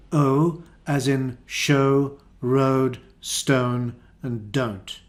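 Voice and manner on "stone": normal talking voice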